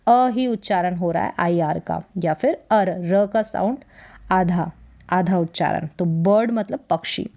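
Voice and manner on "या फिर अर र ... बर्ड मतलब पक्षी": ordinary delivery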